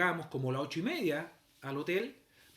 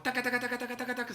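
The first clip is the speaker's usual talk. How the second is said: high, monotone taca-taca heel noise; staccato mimicked footsteps; clacking heels; 6 semitones higher, faster than usual. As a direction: imitate heels hurrying away. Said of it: "Con los tacos".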